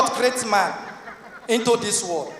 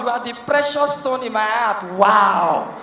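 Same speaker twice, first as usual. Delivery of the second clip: ordinary talk, nothing marked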